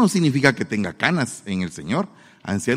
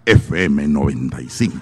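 radio announcer; 11 semitones lower than usual. Retold or imitated. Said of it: "solo faltaba que dijera".

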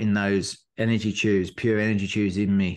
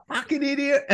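much higher mock-annoyed mate. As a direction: impersonate a mate cursing a friend. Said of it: "his mate was like".

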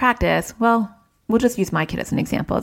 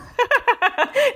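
amused laugh. Real, not acted. Laughs at son's plunger idea.

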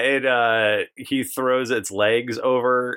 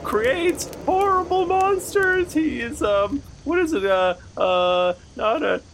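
high squeaky clown voice; whiny high-pitched clown; silly clown. Acted. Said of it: "That's what clowns sound like".

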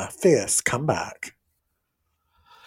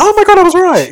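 much higher, louder burst of vindication; real excited yelp at being right; triumphant shriek; 16 dB louder than usual. Real, not acted. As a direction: squeal it like you just won a bet. Proven right about Irish custom.

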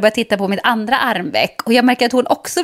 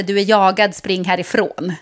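usual speaking voice

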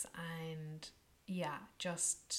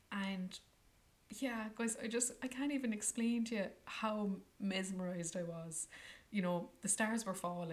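ordinary talk, nothing marked

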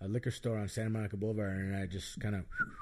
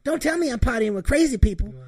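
much higher, louder put-on woman's voice; Diamond's voice; 13 semitones higher, 10 dB louder than usual. Imitating Diamond in the tent, high loud exasperated voice. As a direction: play her shrill-free but high and loud. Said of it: "And she goes".

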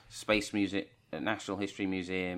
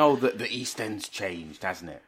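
put-on old East Ender voice; 5 dB louder, slower than usual. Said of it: "they sit there going".

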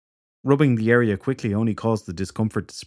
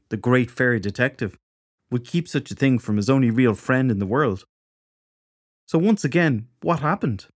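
put-on young woman voice; 3 semitones higher than usual. Shelley voiced in dialogue.

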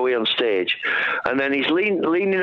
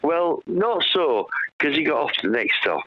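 ordinary talk

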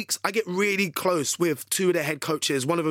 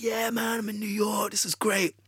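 higher-pitched, delighted self-talk; retold excitement; 4 semitones higher than usual. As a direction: replay the thrill of arriving, pitched up. Retold delight at being in New York.

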